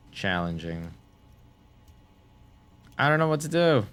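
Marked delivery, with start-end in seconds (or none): 3.0-3.8 panicked. Real, not acted.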